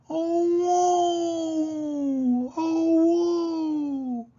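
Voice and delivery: very high, drawn-out howled woe; wolf howl. Acted. Wolf howling at the moon every night, long high howl. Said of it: "the wolf howls at the moon".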